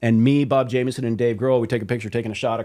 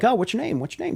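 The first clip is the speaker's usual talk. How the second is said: higher, friendly put-on question; label president asking; 10 semitones higher than usual. Retelling the president meeting band, higher friendly voice. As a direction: chat up the new band. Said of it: "he's like".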